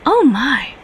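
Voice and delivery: loud, surprised British exclamation; a British man startled by what he finds; British accent. Acted. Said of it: "a male with a British accent said".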